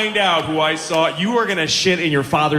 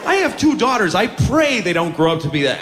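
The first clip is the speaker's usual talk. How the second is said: ordinary talk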